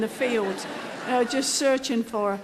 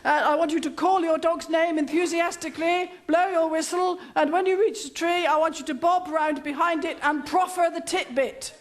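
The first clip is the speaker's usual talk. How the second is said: higher-pitched, hammed-up instructor; bossy trainer instructions; 5 semitones higher than usual. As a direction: rattle off orders like a drill instructor. Trainer gives long instructions.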